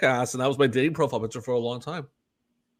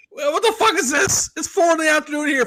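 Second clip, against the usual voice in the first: much higher, louder mock angry shout; hammed-up furious girlfriend; imitated angry girlfriend; 14 semitones higher, 6 dB louder than usual. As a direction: imitate the girlfriend yelling at him. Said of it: "a very angry message".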